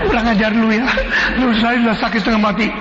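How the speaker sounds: scolding while crying and laughing; half laugh, half cry, scolding; laughing through tears. Acted. Head nurse scolding junior nurse, laughing through tears. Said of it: "sampai menangis, sambil ketawa".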